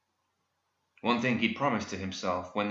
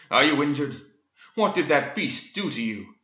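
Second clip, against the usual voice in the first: urgent, raised hero's voice; higher, alarmed romantic-hero questioning; crying out; 4 semitones higher, 4 dB louder than usual. A narrator's reading. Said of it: "he cried".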